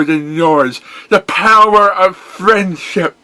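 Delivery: high, loud, resolute vow; defiant pony hero. Acted. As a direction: stand firm against the villain. Hero defies.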